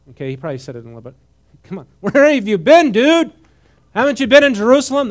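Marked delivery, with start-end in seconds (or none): 1.6-5.1 high, loud, incredulous "come on, dude"; loud, high mock disbelief at a clueless stranger; exasperated casual dude. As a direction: act stunned that he hasn't heard. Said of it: "That's what he was really saying, okay, in our vernacular".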